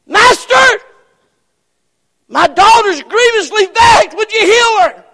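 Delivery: high, loud pleading shout; desperate cry. Acted. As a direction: beg the master at full voice. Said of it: "the Canaanite woman begging Jesus".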